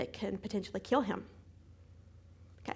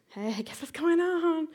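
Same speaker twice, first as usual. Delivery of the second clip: higher, slower teasing tone; hammed-up bright caller; mock perky phone greeting; 7 semitones higher, 4 dB louder, slower than usual. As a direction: draw it out, half-joking. Calling friend with news.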